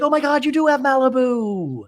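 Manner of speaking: high, thrilled mock Jen; high, gushing mock Jen; excited Jen. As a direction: gush with delight. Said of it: "And Jen's like".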